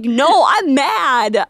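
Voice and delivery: slow, drawn-out pouty complaint; mock mad. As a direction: stretch out a playful sulk. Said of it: "I'm like".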